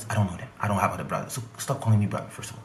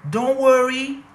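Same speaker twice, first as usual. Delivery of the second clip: high, loud mocking "Don't worry"; mock promoter; 8 dB louder, slower than usual. Comedian mocking the promoter's empty promise, high mock voice. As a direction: throw back the promoter's tired line, high and loud. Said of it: "like she said".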